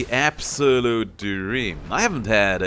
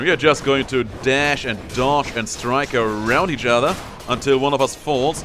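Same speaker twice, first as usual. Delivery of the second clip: ordinary talk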